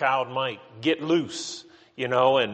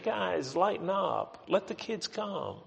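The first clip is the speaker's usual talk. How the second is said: higher, much softer, mild coaxing; soft, gentle; 6 semitones higher, 7 dB quieter than usual. Acted. Jesus mildly asking the disciples to relent, soft and gentle. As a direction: coax them softly in a lifted, gentle voice. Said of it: "that soft, gentle voice of Jesus saying".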